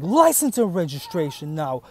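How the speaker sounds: suddenly hostile traffic cop grilling the driver; stern cop. Retold or imitated. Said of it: "Tone changed immediately".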